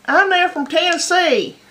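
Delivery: loud, high-pitched Tennessee twang; tight Tennessee accent. Shown as a demonstration. Demonstrating a tightened-up accent, exaggerated Tennessee twang. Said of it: "It's a little more tightened up".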